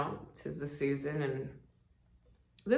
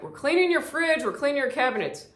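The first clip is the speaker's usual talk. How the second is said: chirpy mock parents; 10 semitones higher, 4 dB louder than usual. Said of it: "they're like".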